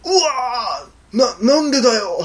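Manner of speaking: high, long-drawn wail then protest; mock death cry, stammering the objection; acted anguished cry. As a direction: wail slowly as if just cut down. Slashed hero's dying protest.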